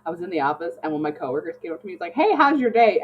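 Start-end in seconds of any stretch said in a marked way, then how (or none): 2.1-3.0 bright, much higher and louder cheery hello; chirpy coworker greeting. Retold or imitated. Retelling a coworker's cheery greeting at the office, high and bright. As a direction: play the oblivious cheerful coworker.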